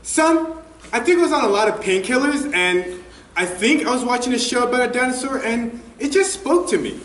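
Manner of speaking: high-pitched, airy mom impression; imitating mom. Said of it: "my mom smiled and laughed and said".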